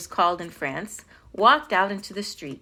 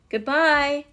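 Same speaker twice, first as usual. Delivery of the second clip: raised, higher farewell call; 6 semitones higher, 5 dB louder than usual. A narrator's reading. Ambassador waving from the dock, calling out. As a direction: call it out across a distance. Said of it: "the ambassador called".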